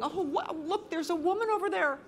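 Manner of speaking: high, astonished fishermen's voices; imitating amazed fishermen. The staring fishermen spot a woman on the pier, high and amazed. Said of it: "these guys are going".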